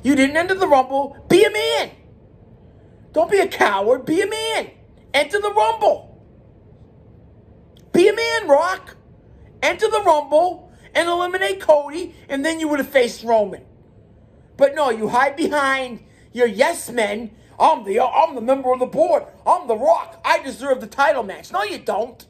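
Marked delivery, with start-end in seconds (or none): 0.0-17.3 high, rising, fired-up scolding; heated rant. Real, not acted. Fan scolding The Rock over the Rumble, higher and heated. 17.6-21.5 lightly voiced smug entitlement; mocking The Rock. Fan parodying The Rock's boasts, a light mock voice.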